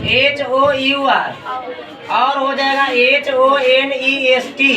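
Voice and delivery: एच ओ यू आर and एच ओ एन ई एस टी spelled letter by letter, slowly; teacher dictating spellings, each letter slow and separate. Shown as a demonstration. Slow, careful dictation for pupils. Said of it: "देखिएगा, ध्यान दीजिएगा".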